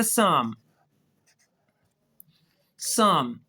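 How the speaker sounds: "साम" said sahm, with no p and no l; silent l and p. Shown as a demonstration. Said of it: "एल तो हो गया silent". ("साम" में पी और एल खामोश)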